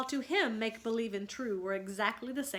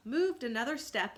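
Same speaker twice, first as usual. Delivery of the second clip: ordinary delivery, nothing marked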